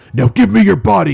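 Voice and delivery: menacing demand. Acted. Sludge villain lunging at Deku, menacing demand. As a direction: lunge at him with the words.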